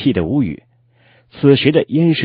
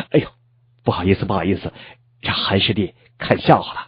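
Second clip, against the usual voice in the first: sheepish, softer and lower; embarrassed apology; 3 semitones lower, 5 dB quieter, faster than usual. A narrator's reading. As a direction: apologise as if caught daydreaming, voice dropping. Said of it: "满脸通红，对自己的出丑行为大为惭愧".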